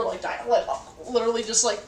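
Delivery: ordinary delivery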